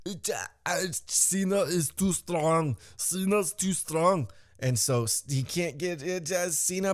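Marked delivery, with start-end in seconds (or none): 0.1-4.3 high, put-on voice, slowing down; stammer, then stretch out the complaint in a raised voice; mock struggling voice. Fan acting the failed hit on Cena, mock struggling voice.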